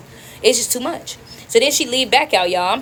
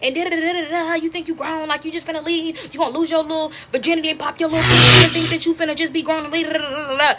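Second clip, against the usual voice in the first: high-pitched imitation of mama's rant; retold motherly tirade; mock yelling; 7 semitones higher than usual. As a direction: nag in a put-on voice without real volume. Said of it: "Now she yelling at me".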